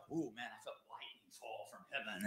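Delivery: breathy, hushed, exaggerated awe; mock sensational. Preacher mocking sensationalized testimony, hushed awe. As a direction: overblown spiritual drama, whispered. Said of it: "'Cause like".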